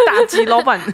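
laughing through the words. Real, not acted. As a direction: crack up mid-phrase. Joking banter, bursts laughing.

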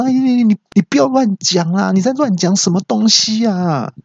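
high, stammering protest; rattled and shouting back; flustered yell. Retold or imitated. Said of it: "然后朋友就大叫说".

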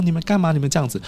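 high-pitched mock distress; imitated panicked shrieking. Wife demands to know what they are doing, mock shrieking. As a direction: voice a frantic wife up high, without really screaming. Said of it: "太太一直在尖叫".

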